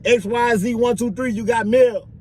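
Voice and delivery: high-pitched mail call; officer calling out. Acted. Officer telling an inmate about mail, calling out.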